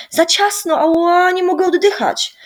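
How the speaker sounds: put-on girl squeezed on the sled, choked and high; choked protest. A narrator's reading. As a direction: protest as if squashed. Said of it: "zduszonym głosem zaprotestowała Zosia".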